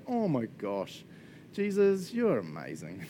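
overwhelmed, breathless adoration; a gushing burst of wonder; awestruck gush. Retold or imitated. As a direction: voice leaping up on "Jesus" and sinking on "you're amazing". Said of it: "this is where I'm just like".

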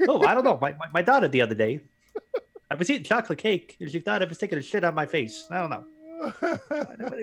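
hammy talk-show comic, pitch jumping up; rambling monologue with high-pitched shrugs; Jay Leno. Acted. Host character telling a story about his daughter, comic impression. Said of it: "do the Jay Leno voice".

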